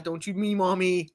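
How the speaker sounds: slow, mock-indignant Southern mama; southern accent. Mama rebuking her daughter on a call, pretend southern accent. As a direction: drawl a playful telling-off, American South style. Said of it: "a very southern accent together".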